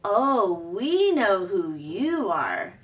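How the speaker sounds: slow, sarcastic bear; dripping sarcasm. A narrator's reading. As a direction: draw it out with mocking sweetness. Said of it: "Her voice dripped with sarcasm".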